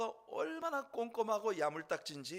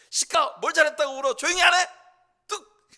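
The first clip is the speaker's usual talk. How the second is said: high, very loud imitated telling-off; explosive acted maternal outburst; mother's scolding; 11 semitones higher, 16 dB louder than usual. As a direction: shout down a crying child like an angry mother. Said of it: "이러면서 어머님이 야단을 치시죠".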